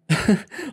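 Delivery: high short giggle; girlish giggle. A narrator's reading. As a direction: let a giggle slip. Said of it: "Kerry giggled".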